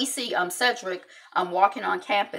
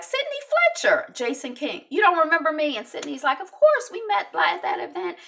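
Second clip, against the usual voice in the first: awkward greeting; 10 semitones higher, 5 dB louder than usual. Retold or imitated. Jason and Sydney meet awkwardly, high chirpy voices. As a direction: chirp it like a forced hello.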